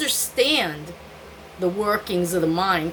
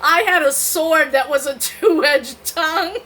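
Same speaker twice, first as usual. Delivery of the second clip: laughing flub; 5 semitones higher, 5 dB louder than usual. Shown as a demonstration. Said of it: "let me try that again".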